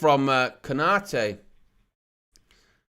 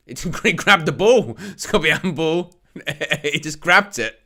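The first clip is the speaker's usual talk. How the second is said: amused laughing. Real, not acted. Commentator watching a player grab the ball, laughing. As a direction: laugh through the words.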